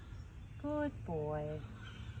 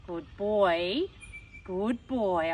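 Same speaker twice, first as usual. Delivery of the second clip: put-on louder, stern, grumpy good boy, good boy; 4 dB louder, much slower than usual. Shown as a demonstration. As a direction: scold the dog with a firm good boy. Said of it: "a really stern, grumpy tone".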